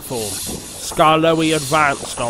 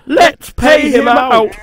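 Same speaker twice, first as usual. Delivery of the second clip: vengeful coaches; 9 semitones higher, 8 dB louder than usual. Acted. Coaches plotting revenge.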